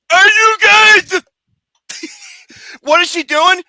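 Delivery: high, loud, laughing disbelief. Retold or imitated. Watching the scream scene, laughing in loud disbelief. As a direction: blurt it out through laughter.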